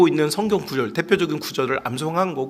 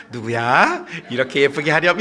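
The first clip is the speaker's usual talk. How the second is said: low, sweetly measured request; prettily gentle; 3 semitones lower than usual. Acted. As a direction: ask nicely in a lowered voice. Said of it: "이렇게 말하고 있을까요".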